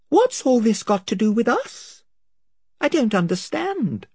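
lightly voiced girl, confused; young girl Lucy. A narrator's reading. Lucy speaks.

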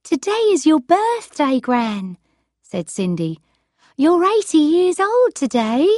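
cheerful granddaughter voice; young woman voice. Acted. Cindy voiced by reader.

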